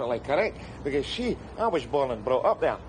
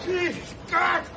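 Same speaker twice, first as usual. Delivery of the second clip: strained shout; 12 semitones higher than usual. Acted. Hauling man from river.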